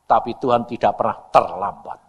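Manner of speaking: normal talking voice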